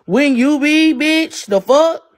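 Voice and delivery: loud, high indignant challenge; incensed comeback; angry snapping back. Real, not acted.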